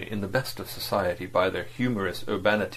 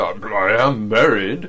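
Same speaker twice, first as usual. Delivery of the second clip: a lightly voiced stolid agent, slow; Verloc's voice; 7 dB louder, slower than usual. A narrator's reading. Agent answering his employer, slow and heavy.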